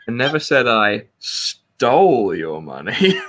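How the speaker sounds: slow, with "stole" stretched and punched; stress on STOLE. Shown as a demonstration. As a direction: draw out "stole" with heavy weight. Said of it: "You can put the emphasis".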